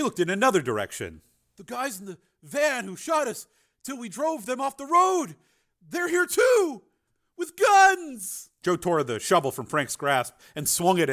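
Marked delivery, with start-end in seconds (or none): 1.6-8.5 high raving that builds to a shout; crazed alarm. A narrator's reading. Crazed brother sees gunmen, crazed alarm. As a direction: build to a panicked cry.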